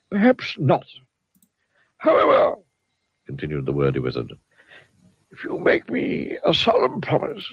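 high, rambling wizard voice; wordy wizard. A narrator's reading. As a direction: dither like a muddled old wizard.

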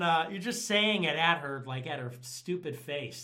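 ordinary talk

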